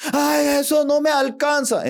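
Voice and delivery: put-on high, complaining child's voice; demanding child. Child demanding ten from mother, high and complaining.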